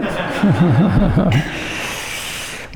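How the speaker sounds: long, trailing chuckle; real chuckle. Real, not acted. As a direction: chuckle at your own aside. Chuckles at own [name] aside.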